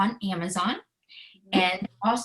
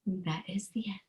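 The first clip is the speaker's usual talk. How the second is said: soft whisper to close the story; whispered ending; 6 dB quieter than usual. Acted. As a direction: breathe the last line out in a whisper. Closing the book reading.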